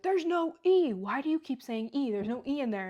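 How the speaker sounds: loud, high mock scolding, easing off; retold exasperated yell; exasperated teacher yelling. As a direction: imitate a teacher losing his patience. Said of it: "your dad's, like, yelling at you".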